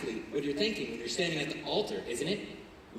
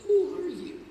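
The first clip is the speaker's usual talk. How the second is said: mock inner panic; 6 dB louder, slower than usual. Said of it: "you're thinking".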